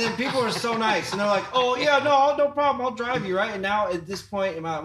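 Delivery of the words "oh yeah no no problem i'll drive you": high, louder eager helpful voice; mock friendly host. Hosts offering the comedian a ride, high eager voice. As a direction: play the overly nice host.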